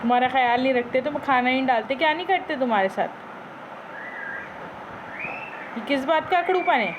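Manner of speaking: pretend-stern owner scolding her parrot; mock scolding. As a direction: tell off the pet in mock anger, half teasing.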